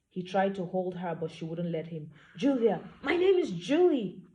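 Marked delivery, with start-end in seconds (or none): 2.4-4.2 fed-up correction. Acted. Heroine corrects him, fed up.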